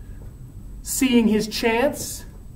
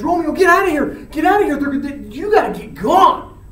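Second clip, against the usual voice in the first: high, louder frantic urging; acted urgent warning; 7 semitones higher, 5 dB louder, faster than usual. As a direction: push a friend to flee at once. Benvolio urging Romeo to flee.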